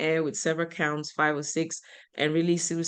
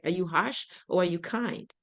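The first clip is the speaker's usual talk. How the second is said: ordinary delivery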